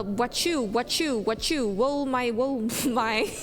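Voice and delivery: ordinary delivery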